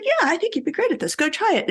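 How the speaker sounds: high, bright mock encouragement; encouraging voice. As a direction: cheer her on, imitating the encourager. Retold encouragement from somebody.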